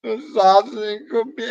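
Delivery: high, wailing mock sob voice; hammed-up crying plea; imitating crying. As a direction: whine it out like a grieving son in tears. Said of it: "he's crying".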